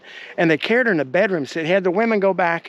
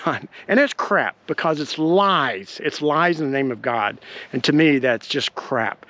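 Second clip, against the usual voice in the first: ordinary talk, nothing marked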